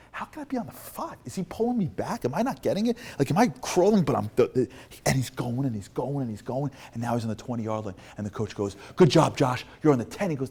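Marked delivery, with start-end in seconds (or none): 0.1-4.0 high-pitched bewildered inner voice; incredulous. Retold or imitated. Player's disbelieving thoughts acted out. 8.9-10.3 loud, raised encouraging call; coach calling out. Retold or imitated. Coach calls to crawling player.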